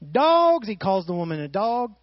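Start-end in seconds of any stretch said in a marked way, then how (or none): 0.1-0.7 "Dogs" shot out high and loud; loud punchline. Acted. Preacher springing Jesus's word on the congregation, loud and high. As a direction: slam the word "Dogs" as the shock line.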